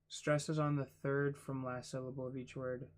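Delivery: usual speaking voice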